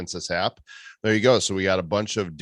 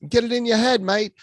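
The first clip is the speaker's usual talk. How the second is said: high, hammed-up Australian accent; 5 dB louder than usual. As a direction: play up an Aussie twang, high. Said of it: "Australia, mate".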